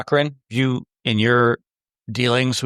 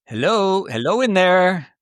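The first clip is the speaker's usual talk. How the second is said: calling out; 7 semitones higher, slower than usual. Acted. Knocking on robot's helmet.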